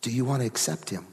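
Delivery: low, soft near-whisper; retold whisper. Preacher asks privately in his ear, hushed. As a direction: lean in and ask it very quietly. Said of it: "he whispers in my ear".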